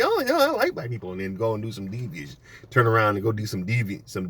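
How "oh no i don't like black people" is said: very high sugary mock voice; mock fake-friendly. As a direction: say it breezily high. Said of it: "they be".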